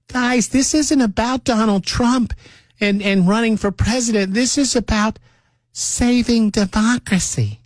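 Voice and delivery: high-pitched mocking put-on voice; mocking imitation. Mocks Democrats' line, then "think we're stupid.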